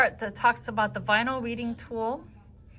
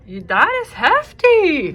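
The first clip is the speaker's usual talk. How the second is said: drawn-out, loud, high surprise; stretched-out impressed exclamation; playful amazement; 6 semitones higher, 9 dB louder, slower than usual. Real, not acted. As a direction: marvel slowly at the weight. Reacts to weight of tool.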